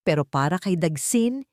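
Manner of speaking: ordinary delivery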